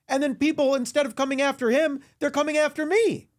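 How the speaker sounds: high, loud indignant protest; indignant rant. Acted.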